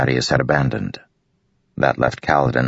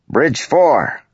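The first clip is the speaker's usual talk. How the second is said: high, loud call across the plateau; 13 semitones higher, 4 dB louder than usual. A narrator's reading. Matal shouting across the plateau to Bridge Four, loud call. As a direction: shout to summon the crew. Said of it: "Matal called".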